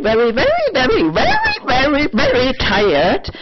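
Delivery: exasperated, pitch leaping up on repeated words; emotional. Real, not acted. Vlogger fed up with being judged, emotional and exasperated. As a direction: build up the repeated words, pitch swooping high. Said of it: "getting emotional again".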